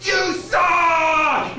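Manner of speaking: loud, high heckle from the back; heckler yell. Retold or imitated. A heckler at the end of the bar yells at the comic. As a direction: shout it at the stage. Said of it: "he's like".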